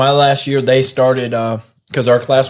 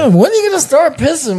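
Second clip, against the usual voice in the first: high-pitched, mock-puzzled onlooker voice; nosy onlooker; 13 semitones higher than usual. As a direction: imitate a weirdo watching, pitched way up. Acting out someone watching him pee.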